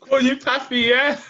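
hammed-up Spanish lover voice, laughing; mock Spanish accent. Mocking his friend's Spanish pillow talk in a laughing accent. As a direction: tease him in a put-on Spanish accent. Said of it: "We're putting on full accent as well".

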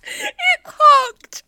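very high, loud squeal through real laughter; real helpless cracking up; laughing at herself. Real, not acted. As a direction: barely get the punchline out. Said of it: "I'm still laughing at myself".

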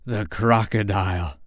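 gasped. Acted. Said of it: "he gasped".

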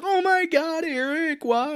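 high, loud mock sobbing cry; hammed-up wailing. Mocking the McDonald's girl's overacted grief, high and loud. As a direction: overact a grieving girl. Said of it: "She's like".

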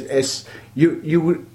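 ordinary talk, nothing marked

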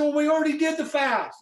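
very high-pitched retold objection; mock-indignant pushback; acted protest. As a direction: protest as if it's unfair, voice shooting up. Acted protest to God's command.

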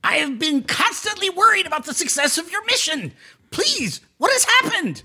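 high, anxious kobold king; Spazlatrash's voice. Acted. Spazlatrash asks the party about their mission, high and fretful. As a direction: pitch it up, fretful little ruler. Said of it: "DM voices Spazlatrash".

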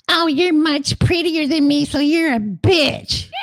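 very high squeaky mocking voice, shouted at the end; mock dog owner. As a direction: shriek it like a silly pet owner. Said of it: "look at their dog and go".

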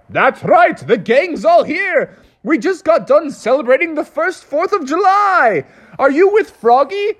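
high, jolly group voice; proud old patriots welcoming him; founding fathers voice. Acted. Founding fathers cheerfully greet the owner in a high voice.

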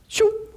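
whoosh sound. Retold or imitated. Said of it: "those horses would".

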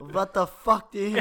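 put-on loud laughing Russian accent yell; thick Russian accent. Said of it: "like, a thick Russian accent".

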